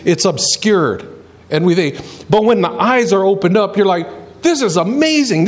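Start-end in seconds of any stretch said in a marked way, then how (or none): 4.4-5.5 higher-pitched burst of wonder; amazed. Acted. Believer seeing scripture with opened eyes, amazed. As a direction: exclaim it as a sudden discovery.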